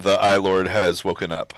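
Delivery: normal talking voice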